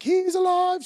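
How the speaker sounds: high, loud, thrilled exclamation; excited cry. Acted. Mary clinging to Jesus, crying out in excited joy. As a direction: burst out with joy.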